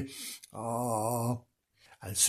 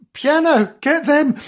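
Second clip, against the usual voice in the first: high, louder cheer for the chase; excited cheer; 12 semitones higher, 4 dB louder than usual. A narrator's reading. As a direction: egg them on.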